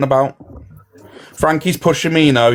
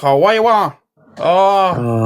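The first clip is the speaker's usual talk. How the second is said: raised, exaggerated comic voice; put-on character voice; 5 semitones higher, much slower than usual. Streamer putting on a voice for laughs, comic character voice. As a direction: pitch it up into a mock character.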